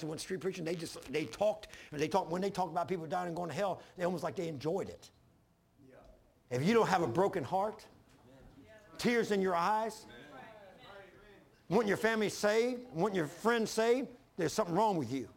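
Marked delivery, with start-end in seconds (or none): none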